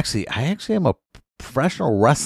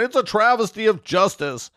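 mock outrage; 9 semitones higher than usual. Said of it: "Everyone's going".

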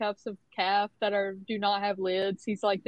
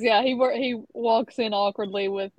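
normal talking voice

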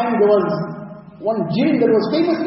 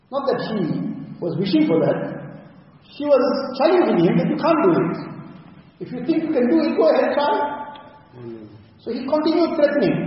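ordinary talk, nothing marked

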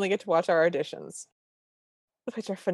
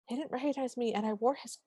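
quoted softly; 5 semitones higher, 7 dB quieter than usual. Retold or imitated. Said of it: "And she goes".

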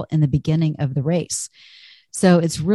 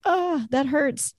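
a higher, softer, slow playful "ouch" at the stretch limit; hammed-up plea to stop, drawn out and pitched up; mock cry of pain; 8 semitones higher, 4 dB quieter, slower than usual. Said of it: "wanting to cry out".

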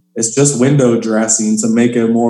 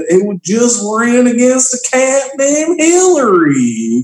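country accent; 11 semitones higher than usual. Shown as a demonstration. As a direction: drawl it like a bar regular. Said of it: "I would do it in a super country accent".

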